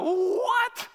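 high shouted disbelief; the crowd shocked at Judas Iscariot. Retold or imitated. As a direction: gasp it out as one shocked crowd. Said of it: "people in the crowd go".

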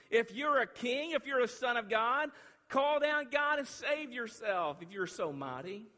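high, shouted challenge; loud jeering taunt. Acted. Crowd jeering Jesus on the cross, loud and taunting. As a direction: hurl it as mockery.

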